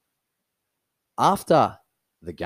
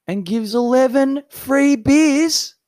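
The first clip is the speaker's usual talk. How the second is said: high, loud, drawn-out mock outrage; incredulous outrage; 7 dB louder, slower than usual. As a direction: deliver it as a loud, high-pitched scandal. Mock outrage at teammate's betrayal.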